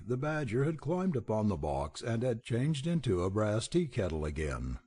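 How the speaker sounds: usual speaking voice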